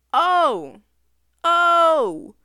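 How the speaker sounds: very loud, high gasps; loud mock shock. Real, not acted. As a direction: overdo the surprise.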